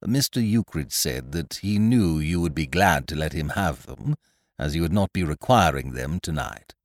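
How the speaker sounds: a hint of a low, quiet butler; measured, prim servant's reply; lightly voiced landlord.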